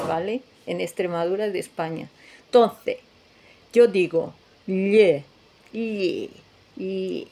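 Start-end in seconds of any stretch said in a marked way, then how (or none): 4.7-7.3 "Lle" drawn out with tongue body squashed on palate; palatal lh demo. Shown as a demonstration. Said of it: "cara de asco".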